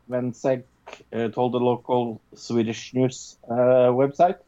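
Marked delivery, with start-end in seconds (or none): none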